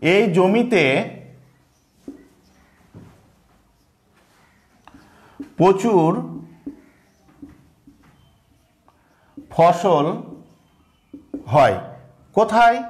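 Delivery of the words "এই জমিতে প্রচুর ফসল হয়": dictating word by word with long pauses; slow dictation. Shown as a demonstration. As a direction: spell it out slowly for the class to copy. Dictating example sentence slowly. (ধীরে ধীরে শ্রুতলিপি)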